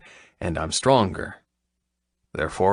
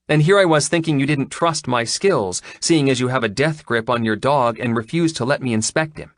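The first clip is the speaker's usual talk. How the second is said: higher-pitched woman, mildly sarcastic; lightly voiced sarcastic vet; 6 semitones higher, 3 dB louder than usual. Vet answers her client's doubts, sarcastic. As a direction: lightly voice a sceptical female vet.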